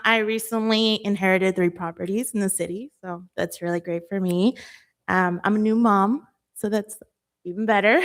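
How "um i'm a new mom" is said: ordinary talk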